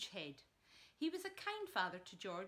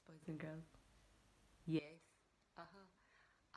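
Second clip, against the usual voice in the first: usual speaking voice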